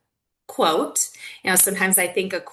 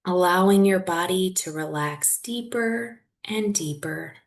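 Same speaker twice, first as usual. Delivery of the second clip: guided meditation. Real, not acted. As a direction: guide them gently through it, leaving long silences.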